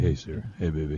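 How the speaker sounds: low, seductive crooner voice. Pretend. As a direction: purr it low like a soul crooner.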